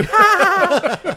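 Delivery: high squealing little-girl laugh imitation; imitating his daughter's delighted squeal; toddler's laugh. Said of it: "She's like".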